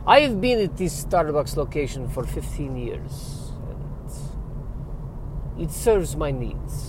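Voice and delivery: put-on stately, drawn-out Dracula-style accent; Eastern European vampire accent. Vampire barista describing his Starbucks job, Eastern European accent. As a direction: answer small talk in a slow Eastern European vampire accent. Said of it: "if you need your accent".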